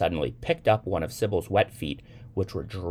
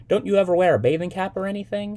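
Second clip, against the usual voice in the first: light teasing voice; 7 dB louder, faster than usual. A narrator's reading. Young man teasing Sybil.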